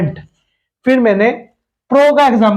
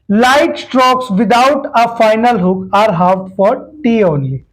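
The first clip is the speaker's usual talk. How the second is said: slow dictation; much slower than usual. Shown as a demonstration. Reading the rule out slowly.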